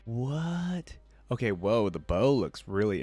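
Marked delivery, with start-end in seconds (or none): none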